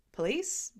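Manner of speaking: plain reading voice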